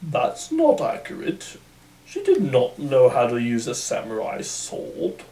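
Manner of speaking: pedantic historian. Retold or imitated. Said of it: "I can just see historians in the theater".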